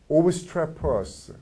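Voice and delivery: obstreperous dragged out very slowly; slow drilled obstreperous. Shown as a demonstration. Teacher drilling a word for students, slowly. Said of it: "vocabulary drill".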